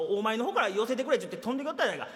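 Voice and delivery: ordinary talk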